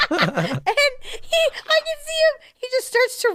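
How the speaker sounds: words squeezed out through high laughter; giggling too hard to speak; real laughter breaking up the words; laughing. Real, not acted. Laughing at her own story.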